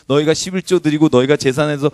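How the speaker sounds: ordinary delivery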